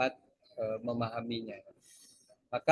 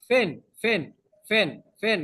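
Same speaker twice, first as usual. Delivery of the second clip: higher Egyptian Arabic "fen" repeated quickly; colloquial Arabic; 5 semitones higher, 4 dB louder, much slower than usual. Shown as a demonstration. Teacher shows how Arabs say where in dialect. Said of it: "Dia ngomongnya".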